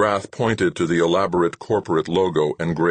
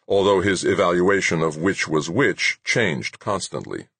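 plain reading voice

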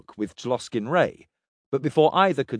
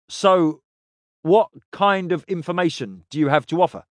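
louder, pressing for an answer; impatient; 5 semitones higher, 4 dB louder than usual. A narrator's reading. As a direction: demand it with impatience. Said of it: "Tloskin Ray said impatiently".

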